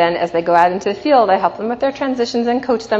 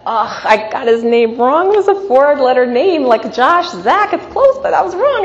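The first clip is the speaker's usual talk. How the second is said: higher, louder self-scolding thought; embarrassed inner voice; 6 semitones higher, 5 dB louder than usual. Retold or imitated. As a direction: scold yourself inside your head. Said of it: "And I thought".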